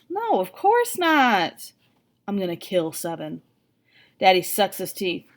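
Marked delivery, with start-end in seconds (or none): 0.1-1.7 slow, drawn-out innocent no, pitch lifted high; forced cheerful denial. A narrator's reading. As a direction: sell the lie with a forced smile. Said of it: "I force a smile".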